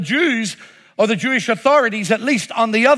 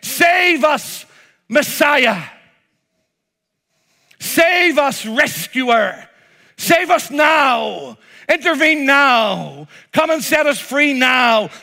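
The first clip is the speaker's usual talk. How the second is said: crowd shouting; 5 semitones higher, slower than usual. Retold or imitated. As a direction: beg the rescuer to act now. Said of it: "ordinary men and women shouting".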